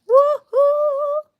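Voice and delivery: high, loud celebratory woo-hoo; a happy high whoop of triumph; excited whoop. Real, not acted. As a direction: cheer like you just nailed it. Celebrating finished bead.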